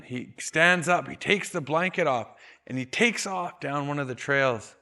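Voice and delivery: ordinary talk, nothing marked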